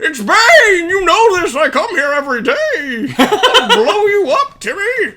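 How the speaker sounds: high, loud, laughing Bane yell; angry Bane shout. Retold or imitated. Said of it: "and it's like".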